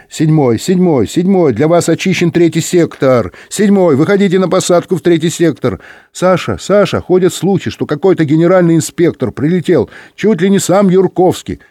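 high-pitched, insistent radio calling; urgent high dispatcher chatter; radio dispatcher voices. Acted. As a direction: call out over a crowded airwave.

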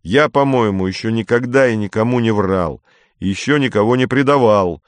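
ordinary delivery

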